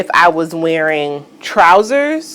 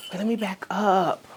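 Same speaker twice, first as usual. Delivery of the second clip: ordinary talk, nothing marked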